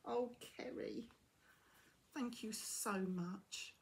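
usual speaking voice

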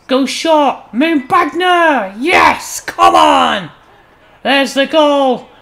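building to a drawn-out, high celebratory shout; jubilant goal call. Real, not acted. Commentator celebrating his team's equalising goal, shouting in triumph. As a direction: roar the scorer's name and cheer.

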